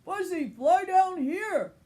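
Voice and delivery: loud high call up. Acted. Marshall calling Fuzzy off the roof, loud high call. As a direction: call up to the rooftop. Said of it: "Marshall called".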